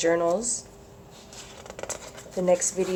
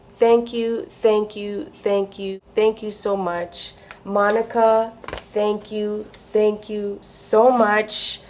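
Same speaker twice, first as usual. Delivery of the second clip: normal talking voice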